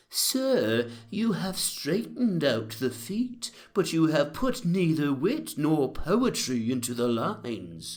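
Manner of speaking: Johnson's rebuke. A narrator's reading. Johnson dismisses the narrator's verse, lofty rebuke. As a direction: deliver the witty rebuke with mock grandeur. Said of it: "he said".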